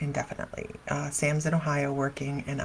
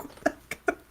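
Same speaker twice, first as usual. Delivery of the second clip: breathless, stunned whisper; shocked whisper; 6 dB quieter, faster than usual. Retold or imitated. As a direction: gasp it out in shock. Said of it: "I'm like".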